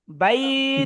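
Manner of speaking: বাইত with a drawn-out ই and a silent-vowel ত; pause form. Shown as a demonstration. Teacher demonstrating the stop to the class. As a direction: hold বাই, then close on a bare ত. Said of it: "কিভাবে?".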